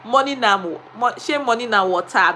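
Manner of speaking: lightly voiced Pidgin saying, mild Nigerian lilt. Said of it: "let me say it in Pidgin".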